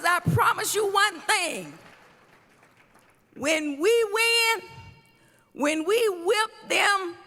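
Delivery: fiery preacher cadence; preaching. Acted. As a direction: preach it to the crowd. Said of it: "I'ma act like I'ma preach".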